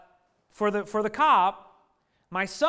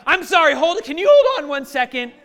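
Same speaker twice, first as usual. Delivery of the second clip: loud, higher-pitched imitation of a frazzled wife; raised-voice comic pleading; mock flustered plea; 4 semitones higher, 8 dB louder, faster than usual. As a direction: blurt it out as her urgent apology to a cop. Imitates flustered wife stalling the cop.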